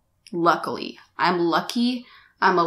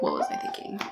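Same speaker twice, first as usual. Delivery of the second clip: low, breathy whisper to herself; whispered thought; 10 dB quieter, much faster than usual. Retold or imitated. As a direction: whisper the question under her breath. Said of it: "I was like".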